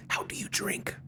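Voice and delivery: whispering hoarsely after choking; barely getting words out; choked whisper. Real, not acted. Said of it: "I can't talk".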